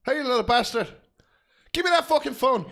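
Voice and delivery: high, loud fake fury; mock angry demand. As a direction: bark it like a furious dad. Mock angry grab for phone.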